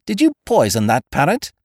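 pointed, mildly accusing question. A narrator's reading. Narrator confronts Ukridge over the parrot, mildly accusing. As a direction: press him for the truth. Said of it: "I gazed at him accusingly".

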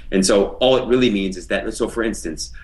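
ordinary talk, nothing marked

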